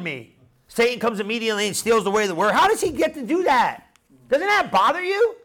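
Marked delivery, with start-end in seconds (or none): 2.5-5.4 high-pitched, indignant questioning; exasperated preacher challenging the room; annoyed. Real, not acted. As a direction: push it up high, bothered and disbelieving. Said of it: "I'm still annoyed at this".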